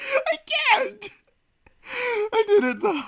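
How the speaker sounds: high, squeaky, spent from laughing; real breathless laughter. Real, not acted. Singer recovers after the anthem, laughing helplessly. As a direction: gasp it out after cracking up.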